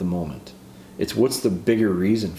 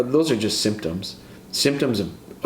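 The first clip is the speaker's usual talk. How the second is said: normal talking voice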